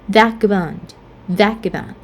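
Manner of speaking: vagabond stressed on VAG, falling; stress on first syllable. Shown as a demonstration. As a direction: say vagabond as VAG-abond, middle syllable light. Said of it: "the first syllable will be stressed".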